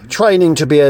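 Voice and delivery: ordinary talk, nothing marked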